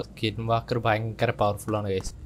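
ordinary delivery

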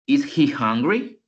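"Is he hungry?" with the voice rising on "hungry"; rising question tune on "Is he hungry?"; question intonation. Shown as a demonstration. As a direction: ask "Is he hungry?" as a real question, lifting at the end. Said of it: "Pero sí debe de ir la entonación".

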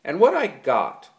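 loud, high furious outburst. A narrator's reading. George to Lenny about his burden, furious. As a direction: let the fury climb again. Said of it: "George went on furiously".

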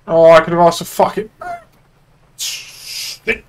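loud angry burst of real frustration; frustrated outburst. Real, not acted. Cop swearing at himself after a missed chance, loud angry outburst. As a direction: blurt it out loud, annoyed at the miss.

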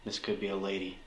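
low, quick, very soft murmur; hushed aside. Real, not acted. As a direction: murmur it aside to your companions. Hushed aside to companions during investigation.

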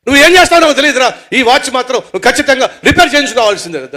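high, loud shouted demand; furious insistence at full voice; angry ultimatum. Acted. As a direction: yell that there are no excuses. Angry friend insists on repair.

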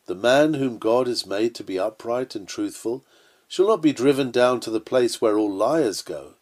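ordinary talk

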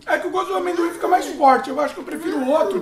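speaking between bites; chewing while talking. Real, not acted. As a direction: mouth partly full. Seen 2: Put snack in mouth at -2.0, jaw working while speaking.

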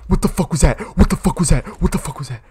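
high, loud, rapid-fire repeats; startled, frantic repetition; panicked yelling. Real, not acted. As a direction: blurt it over and over in fright. Panicking at something in game.

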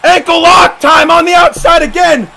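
high, loud, stretched announcement; slow, excited ringside call; shouted play-by-play. Real, not acted. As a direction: herald the hold to the crowd. Live play-by-play.

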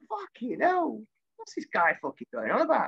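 high-pitched baffled exclamation; mock exasperation at the manager; baffled outrage. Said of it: "I'm going".

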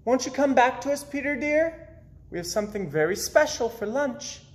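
high, fast sweet calling voice; lightly voiced mother calling sweetly. A narrator's reading. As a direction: coax him in from the window. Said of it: "came to the window and called".

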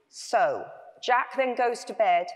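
ordinary delivery

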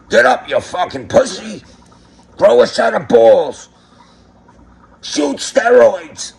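high-pitched, drawn-out mock yell; hammed-up motivational coach shouting canned orders; Wes Watson impression. Recorded coach quote played to a paying caller, mock shouting. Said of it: "it's Wes Watson's voice going".

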